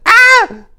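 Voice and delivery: very high, loud scream of pain; a sudden piercing shriek; piercing cry. A narrator's reading. Gabriella crying out as she is attacked, piercing scream. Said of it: "Gabriella's cry pierced the air".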